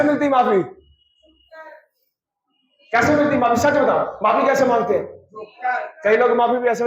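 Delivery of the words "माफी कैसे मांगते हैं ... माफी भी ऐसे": ordinary talk, nothing marked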